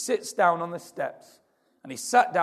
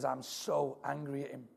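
soft and lower, barely above a murmur; grieving wife, hushed; 3 semitones lower, 10 dB quieter than usual. Retold or imitated. Widow on camera quietly voicing anger at her husband. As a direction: speak it quietly, like grief held in. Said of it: "She says".

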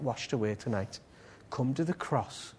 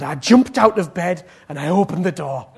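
loud, punched-out quote; animated, forceful retelling; excited retelling; 2 semitones higher, 11 dB louder than usual. Retold or imitated. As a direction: tell it with a burst of urgency. Retells woman's urgent response.

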